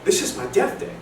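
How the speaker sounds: high, loud cry of dread; mock panic. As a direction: wake up in hammed-up terror. Waking up to expected death.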